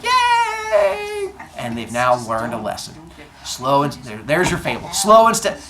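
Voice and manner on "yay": very high, drawn-out squeal; cheering crowd. Acted. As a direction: squeal a long mock cheer. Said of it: "And they're like".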